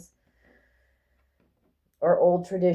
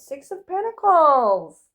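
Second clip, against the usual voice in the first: excited card announcement; 9 semitones higher, 5 dB louder, slower than usual. Real, not acted.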